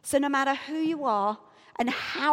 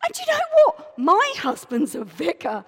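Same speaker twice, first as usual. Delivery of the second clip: loud, high self-important outburst; all high-pitched; 5 semitones higher, 9 dB louder than usual. Acted. Heard herself telling the woman about church, all high-pitched. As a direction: start high and push it loud. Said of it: "I'm getting all high-pitched, just like I was then".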